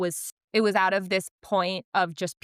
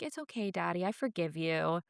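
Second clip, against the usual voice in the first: soft, small-voiced reassurance; gentle forgiveness; 8 dB quieter than usual. Leo forgiving his daddy, gentle forgiveness. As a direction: forgive him softly, simply.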